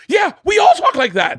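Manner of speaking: high, loud mock outburst; defensive shout. Comic defending his trash talk to a friend, shouting.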